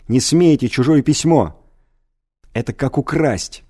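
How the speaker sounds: loud shriek at the teacher. A narrator's reading. Pupil shrieking at his teacher, loud outburst. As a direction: scream it out in outrage. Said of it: "взвизгнул он".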